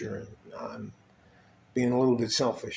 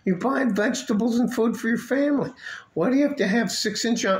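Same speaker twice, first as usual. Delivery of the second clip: higher, incredulous scolding; mock-outraged disbelief at dressed-up shoppers; exasperated rant; 10 semitones higher than usual. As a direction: rant at overdressed supermarket shoppers. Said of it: "You're like".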